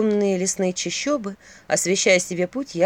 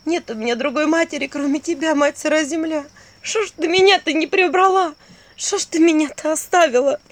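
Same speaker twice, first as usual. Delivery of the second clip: anguished lament; 7 semitones higher than usual. Acted. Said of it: "шептала".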